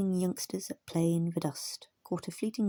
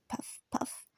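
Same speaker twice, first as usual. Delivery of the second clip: ordinary delivery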